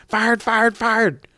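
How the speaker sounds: high-pitched mock yell, no real volume; retold angry yell. Manager firing her tag team over the mic, mock yelling. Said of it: "grabs the mics and yells".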